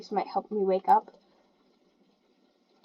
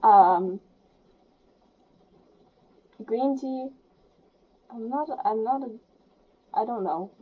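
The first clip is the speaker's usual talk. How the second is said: ordinary talk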